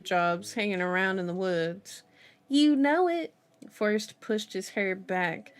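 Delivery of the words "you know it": excited, quick Southern twang; Forrest's Alabama twang. A narrator's reading. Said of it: "Forrest's Alabama twang".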